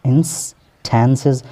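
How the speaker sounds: instances with a flat e, in-sten-siz, American English; American English instances, flat vowel. Shown as a demonstration. Teacher gives the American form: instances with a flat vowel. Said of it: "instances पढ़ेंगे तो ये American English".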